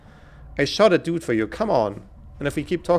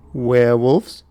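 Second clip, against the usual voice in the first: louder rising shout of werewolves?; loud disbelief; 9 dB louder, much slower than usual. Acted. Realizing the attackers are werewolves, loud disbelief. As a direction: blurt werewolves? out loud in shock. Repeated word, second shouted.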